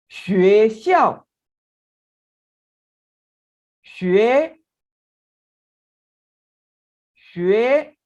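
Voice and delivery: "学校" said slowly and loudly with a rising tone, then "学" alone, rising; Mandarin demo. Shown as a demonstration. Teacher drilling "学校" aloud so students learn its tones. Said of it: "इनको बोल के practice करते हैं ताकि हम बोलने का तरीका भी सीख लें".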